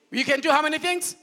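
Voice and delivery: fast shouted question; rising call for an answer; call to congregation. Real, not acted. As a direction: shout it out to the church. Preacher calling out to congregation.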